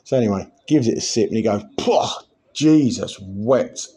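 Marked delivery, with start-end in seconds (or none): 1.8-3.9 splutter, then drag the words out, appalled; hammed-up revulsion after a sip; mock disgust. Bloke tastes the foul pint.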